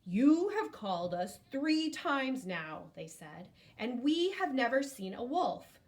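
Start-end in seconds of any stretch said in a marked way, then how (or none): 0.0-2.9 slow, louder, scolding; angry. Acted. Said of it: "they were angry with Joseph". 3.8-5.8 higher, louder scolding; angry. Acted. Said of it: "they were angry with Joseph".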